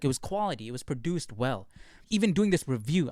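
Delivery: ordinary delivery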